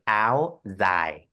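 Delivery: ao dai said "ow zai", northern Vietnamese style; northern Vietnamese "ao dai" with a Z sound in dai; northern Vietnamese accent. Shown as a demonstration. Designer showing how the north says it, northern Vietnamese accent. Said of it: "in the north, the northern Vietnam, they would say".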